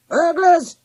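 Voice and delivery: high, loud barking cry; yelled alarm. A narrator's reading. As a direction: sound the alarm like a guard dog. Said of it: "he yelled".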